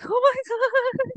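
high, drawn-out gush of wonder; amazed gush. Retold or imitated. Reader telling how the book won her over, amazed. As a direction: stretch it out high, awestruck.

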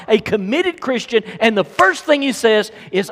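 high, loud sermon delivery; forceful preaching. Real, not acted. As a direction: drive each point home to the congregation.

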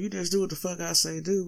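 normal talking voice

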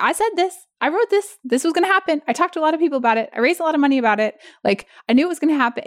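high, fast told-you-so rant; self-righteous. Pretend. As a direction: gloat that you were right all along. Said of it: "I have to sort of be a little self-righteous".

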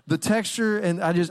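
ordinary talk

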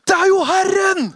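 high, loud joyful cry; excited shout. Acted. Johannes recognizing the Lord from the boat, shouting. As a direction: shout out the discovery. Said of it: "da sier Johannes".

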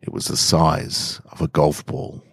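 usual speaking voice

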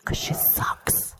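whispered aside. Real, not acted. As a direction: hiss it under your breath. Aside while hands are counted.

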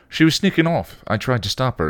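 a hint of Spike; lightly voiced Spike. A narrator's reading. Spike telling Buffy, mildly urgent. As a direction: tell them what happened, urgently.

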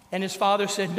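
usual speaking voice

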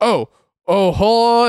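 mock awkward fluster. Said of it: "he's, like, acting all awkward".

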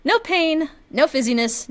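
ordinary talk, nothing marked

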